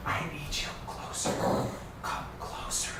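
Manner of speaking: hushed, breathy voice in your head; eerie whisper. Acted. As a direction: whisper it like a voice inside the mind. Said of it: "you hear a voice in your head that says".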